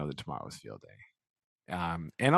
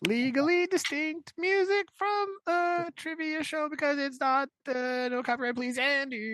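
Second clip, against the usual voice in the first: high silly voice. Acted. Jokey jingle disclaimer voice.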